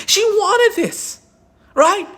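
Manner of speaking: high, slow, drawn-out sarcasm; sarcastic mock. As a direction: stretch it out, dripping with sarcasm. Sarcastic restating of defense.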